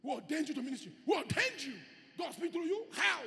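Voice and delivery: loud rising preacher challenge; fiery sermon questions; preaching. Acted. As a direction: hurl the questions at the congregation.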